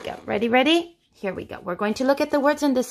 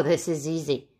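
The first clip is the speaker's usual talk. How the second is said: normal talking voice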